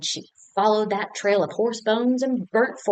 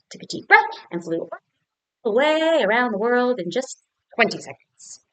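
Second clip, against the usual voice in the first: plain reading voice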